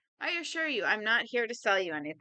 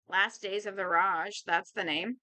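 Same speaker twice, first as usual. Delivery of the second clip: usual speaking voice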